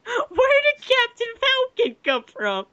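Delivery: high, slow wailing outrage; milk the disbelief even bigger; hammy stage actor. Acted. Repeats louder, slower.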